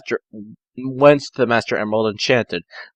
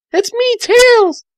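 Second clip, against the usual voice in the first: put-on much higher, slow girlish voice; high girl voice for Tails; 3 dB louder, slower than usual. Tails calling Sonic in a high girlish voice. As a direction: sweetly announce yourself. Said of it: "I said".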